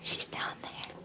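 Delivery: soft unvoiced whisper; hushed pointing out. Real, not acted. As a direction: whisper it, the kitten is close. Watching hidden kitten.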